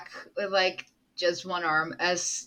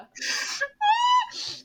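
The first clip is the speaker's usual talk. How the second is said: high squealing laugh-cry. Real, not acted. Player reacting to the sad scene, laugh-cry squeal.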